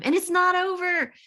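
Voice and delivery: high, loud burst on not over; announcing to everyone that the event continues, high and loud; emphatic excitement. Real, not acted. Fling it out high and loud, it's not over. Rallying the audience at the close.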